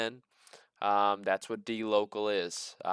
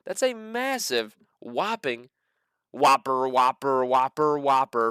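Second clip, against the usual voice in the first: excited; 2 semitones higher, 4 dB louder, slower than usual. Real, not acted. Hyping Walmart's revenue jump.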